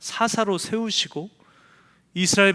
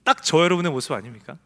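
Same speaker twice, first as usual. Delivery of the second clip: usual speaking voice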